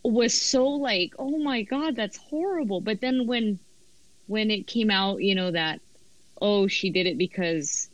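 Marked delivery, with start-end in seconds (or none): 1.2-2.8 higher-pitched, mildly mock dismay; retold horror. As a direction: replay a young reader's shock, pitched up. Acts out younger self's shocked reaction.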